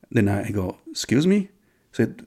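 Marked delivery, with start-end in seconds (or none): 0.9-1.5 high rising incredulous question; taken aback. Retold or imitated. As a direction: retell a taken-aback reply, pitched up. Taken aback by stranger's cussing.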